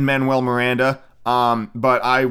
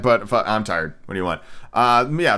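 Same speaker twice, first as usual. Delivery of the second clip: ordinary talk, nothing marked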